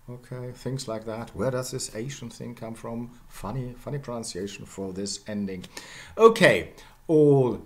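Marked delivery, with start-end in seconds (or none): none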